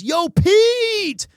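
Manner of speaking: high, loud shouted greeting; excited shout. Retold or imitated. Fan hollering at the streamer, loud excited shout. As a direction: yell out his name across the crowd.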